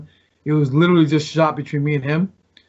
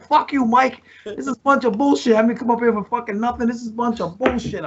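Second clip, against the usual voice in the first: high-pitched indignant tirade, not shouted; retold angry rant; 6 semitones higher than usual. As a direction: re-enact an outraged complaint at a promoter.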